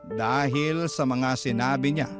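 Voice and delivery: usual speaking voice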